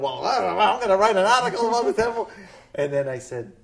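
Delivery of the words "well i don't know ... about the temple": high, loud, hurried mimicry of a colleague; mock flustered colleague. Retelling colleague's threat to write about the temple, mock flustered. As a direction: rattle it off louder and higher, imitating her. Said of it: "and she started going".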